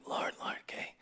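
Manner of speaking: breathy whispered plea to the Lord; hushed, no voice, praying under his breath; whispered prayer. Retold or imitated. Pastor retelling his anxious prayer, whispered.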